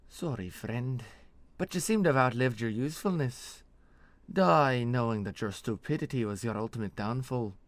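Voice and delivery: mock apology swelling into a loud sentence; Nesrathi executioner, cold. Executioner addressing her victim before the blow, cold menace. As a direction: pass sentence in accented, pointed tones.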